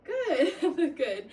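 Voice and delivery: amused laughing praise. Real, not acted. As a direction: chuckle while saying good. Laughs at patient's effort.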